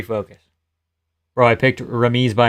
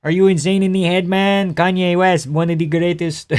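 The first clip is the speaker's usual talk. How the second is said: put-on Quebec accent, higher pitched; 4 semitones higher than usual. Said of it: "[name] is a huge [name] fan".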